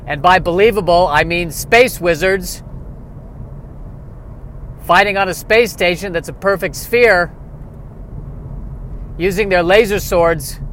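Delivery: Brian Regan style outrage, climbing in pitch; Brian Regan impression. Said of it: "I'm turning into Brian Regan there".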